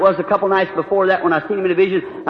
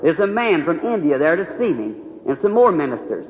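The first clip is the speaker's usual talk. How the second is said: ordinary talk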